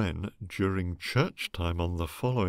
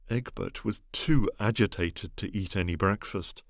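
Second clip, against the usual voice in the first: ordinary delivery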